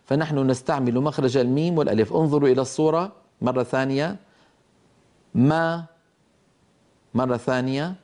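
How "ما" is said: "ما" with lips parting from m into a long open aa; demonstrating open meem. Shown as a demonstration. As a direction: model "ما" as a lone drill syllable, slightly raised. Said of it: "فلما تتباعد الشفتان".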